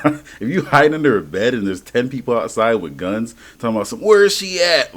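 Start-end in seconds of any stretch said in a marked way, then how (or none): 4.0-4.9 mock shout, high and loud; hunting gunman. Gunmen searching for her as she hides under a bed, mock shout. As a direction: call out like a searcher with a gun.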